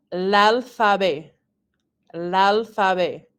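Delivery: "l'alphabet" slowly as one word, lal-fah-bay; words squished together. Shown as a demonstration. French teacher giving an example, "l'alphabet" slow and run together. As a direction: say "l'alphabet" slowly, L joined onto the A, silent T. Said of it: "see how they're squished together".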